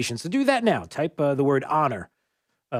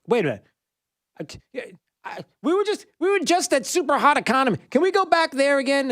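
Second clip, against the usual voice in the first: exasperated; 10 semitones higher than usual. Real, not acted. Exasperated at the news cycle.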